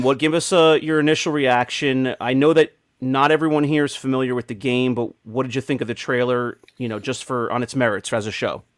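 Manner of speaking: ordinary talk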